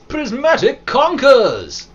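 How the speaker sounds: high, slow, loud cry; hammed-up drawn-out exclamation; mock proclamation. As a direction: call it out grandly, stretched. Mock announcing of conkers.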